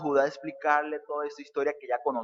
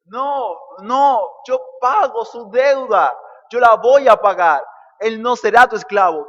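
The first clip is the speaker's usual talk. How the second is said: higher and much louder, crying out to take the debt; impassioned plea; 6 semitones higher, 12 dB louder than usual. Acted. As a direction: shout it as a desperate pledge. Judah's pleading offer acted out.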